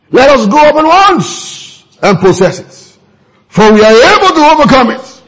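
high-pitched, urgent rallying quote; rallying cry. Retold or imitated. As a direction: quote Caleb urging the crowd on. Quoting Caleb rallying the people.

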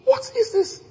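"What is this?" high and soft; awed, hushed question; hushed wonder. Retold or imitated. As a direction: breathe it out in astonishment. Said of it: "He goes".

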